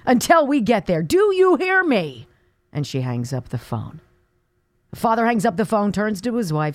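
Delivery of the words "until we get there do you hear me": mock yell. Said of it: "and yells at her father".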